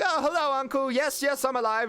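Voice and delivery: high-pitched niece voice; Kenna. Acted. Kenna greeting Uncle on her return, high-pitched. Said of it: "She's like".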